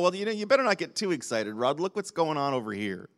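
mock discouragement, trailing down low and soft; discouraging voice. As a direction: quietly talk someone out of their excitement. Enemy's discouraging voice acted out.

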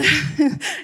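a bright, bubbling laugh; happy giggle; light laugh. Real, not acted. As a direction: laugh out loud with delight. Laughing while praising her husband.

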